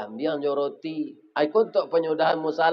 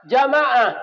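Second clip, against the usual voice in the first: Jamaah called out high and loud; preacher calling Jamaah out to the congregation, high and loud; 9 semitones higher, 6 dB louder than usual. Real, not acted. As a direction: call Jamaah out to the whole congregation. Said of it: "harus giat membangunkan jamaah".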